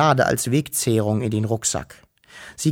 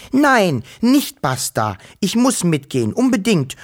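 defiant; 7 semitones higher, 3 dB louder than usual. Acted. Girl defies father.

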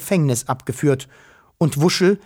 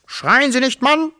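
sharply raised, high-pitched yell; shouting back; 12 semitones higher, 3 dB louder than usual. A narrator's reading. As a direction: shout down the man you are arguing with. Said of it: "schrie der Polizeichef". (mit hoher Stimme zurückschreien)

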